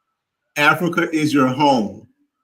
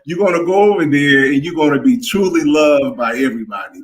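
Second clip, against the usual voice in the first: ordinary delivery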